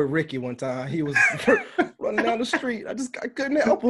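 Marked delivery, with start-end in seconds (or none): 1.2-3.9 talking through real laughter, much higher; can barely get the words out for laughing. Real, not acted. Laughing while telling story.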